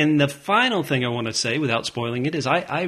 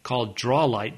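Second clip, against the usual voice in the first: usual speaking voice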